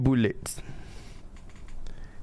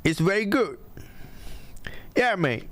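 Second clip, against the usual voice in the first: mock British accent; 6 semitones higher than usual. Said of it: "habis British".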